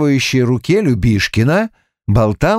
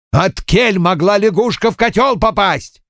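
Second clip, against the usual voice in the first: higher-pitched demanding question; insistent questioning; 7 semitones higher than usual. A narrator's reading. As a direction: press for an answer. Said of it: "допытывался Любишкин". (допытывается, настойчиво)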